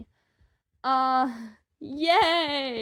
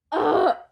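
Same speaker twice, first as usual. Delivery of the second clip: loud open scream of delight; real scream; 5 semitones higher, 9 dB louder than usual. Real, not acted. Telling viewers she can scream now, loud joyful scream. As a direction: let the scream out fully. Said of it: "I'm screaming now because I can".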